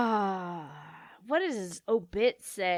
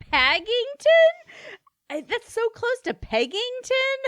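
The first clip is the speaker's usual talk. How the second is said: amused disbelief; 13 semitones higher, 4 dB louder than usual. Real, not acted. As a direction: repeat the name in amused shock. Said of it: "laughs Yeah".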